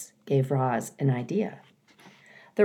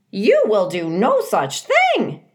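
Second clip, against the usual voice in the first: loud, slow indignant squawk; indignant goose; 5 semitones higher, 5 dB louder, slower than usual. A narrator's reading. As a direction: put your foot down like a goose. Goose objects.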